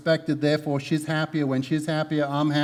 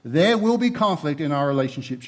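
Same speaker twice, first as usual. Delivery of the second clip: ordinary talk, nothing marked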